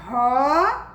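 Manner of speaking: "Rosa" esticado em "rooo... za"; leitura silabada. Shown as a demonstration. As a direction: leia "Rosa" devagar, em duas sílabas. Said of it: "com som de Z". ("Rosa" silabado, com som de Z)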